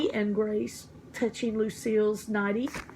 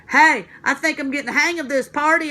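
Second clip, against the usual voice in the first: higher, louder, cheerful; very happy; 4 semitones higher, 7 dB louder than usual. Acted. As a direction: beam with pride at the party. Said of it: "I said, very happy".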